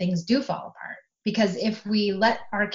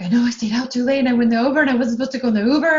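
put-on high, loud rushed teen confession; imitating her daughter; 5 semitones higher, 7 dB louder, much faster than usual. Mother voicing her daughter's rushed confession, loud and high. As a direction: blurt it out fast like a guilty teen. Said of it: "She's like".

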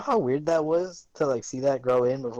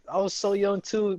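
normal talking voice

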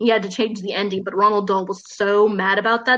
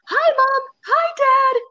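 very high, bright squeaky greeting; cheery mouse; 3 dB louder, slower than usual. Retold or imitated. Mouse boy greets his parents from the purse, squeaky and high. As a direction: chirp it like a tiny happy mouse. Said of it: "the mouse is like".

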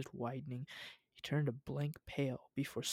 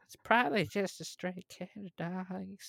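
put-on higher-pitched young officer character voice; younger officer's voice; 5 semitones higher than usual. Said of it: "read that voice".

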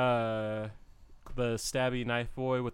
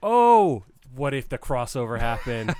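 excited idea; 8 dB louder than usual. Real, not acted. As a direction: burst out with the idea.

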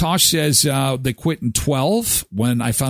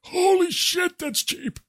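mock shock; 4 dB quieter, slower than usual. Real, not acted. Reacts to 59 cent packs.

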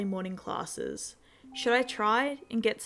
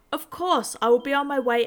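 urgent; 4 semitones higher, 7 dB louder than usual. A narrator's reading. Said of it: "typed furiously".

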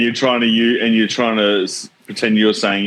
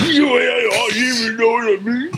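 much higher whiny mock voice of a flustered mate; mocking his mate; 13 semitones higher than usual. Mocking his co-host as a flustered sweating mate, squeaky and whiny. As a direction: ham it up, squeaky and indignant. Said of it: "He'd be sweating in the garage".

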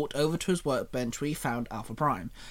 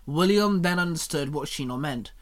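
plain reading voice

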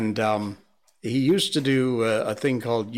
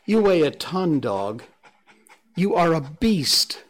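loud strained voice; 4 semitones higher, 5 dB louder than usual. Real, not acted. Straining under heavy dog.

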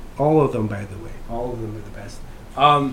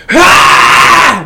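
mock goddess scream; 5 semitones higher, 10 dB louder than usual. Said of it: "with her tits out screaming".

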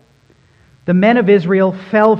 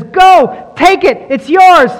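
much higher, louder commanding call; voice of the Lord; 11 semitones higher, 9 dB louder, slower than usual. Acted. The Lord commands Israel at the border, a loud high call. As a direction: boom out the divine command. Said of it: "the Lord says".

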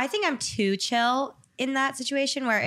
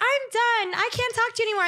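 dramatic; 13 semitones higher than usual. Retold or imitated. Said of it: "I'm always like".

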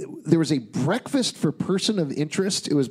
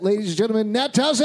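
announcer introduction; 5 semitones higher, faster than usual. Acted. Introducing guest.

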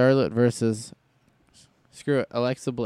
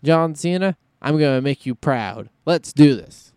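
hyped-up mock pep talk; 5 semitones higher, 6 dB louder than usual. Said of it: "but he's like".

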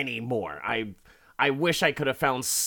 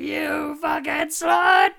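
much higher, louder, drawn-out screamed insult; furious slow scream; 8 dB louder than usual. Retold or imitated. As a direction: scream it while smashing the door. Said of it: "He's like".

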